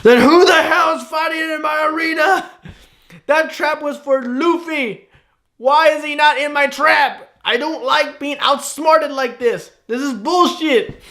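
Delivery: villain yelling at a screen over a ruined trap; Doflamingo outburst. Retold or imitated. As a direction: rant like a villain who got outsmarted. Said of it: "And he's just like".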